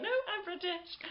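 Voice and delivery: very high-pitched, squeaky insistence; mock protest. Retold protest to admiring guests.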